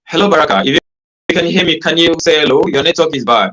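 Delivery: loud, high calling out; to someone hard of hearing. Real, not acted. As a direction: call out over a bad connection. Calling to a guest on a bad line.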